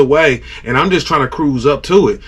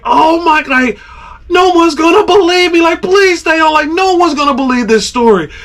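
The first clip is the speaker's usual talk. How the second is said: frantic excitement; 10 semitones higher than usual. Retold or imitated. Angler fighting a record fish, frantic pleading. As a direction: beg the fish to stay on.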